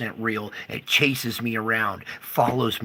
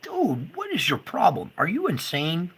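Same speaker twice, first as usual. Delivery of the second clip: higher, exasperated yell at the cop; retold outburst; 7 semitones higher than usual. Telling off a police officer, exasperated. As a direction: scold him like he's lost it. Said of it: "I'm like".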